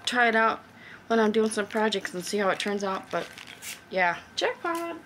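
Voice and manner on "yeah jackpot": high, slow jackpot; a drawn-out happy jackpot; delighted. Real, not acted. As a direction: savour the win, pitched up. Delighted at bargain find.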